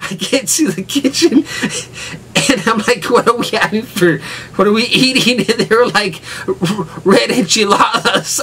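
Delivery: can barely get the words out for laughing; giggling while retelling. Real, not acted.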